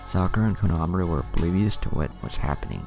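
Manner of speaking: ordinary delivery, nothing marked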